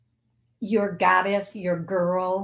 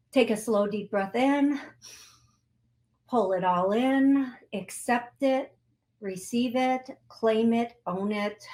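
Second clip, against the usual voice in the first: guided breathing; 3 semitones higher than usual. Acted. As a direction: coach them gently through the breath.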